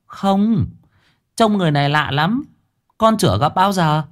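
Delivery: put-on high child's voice, wide-eyed; a young boy describing a stranger; boy's answer. A narrator's reading. (giọng cậu bé cao, ngây thơ)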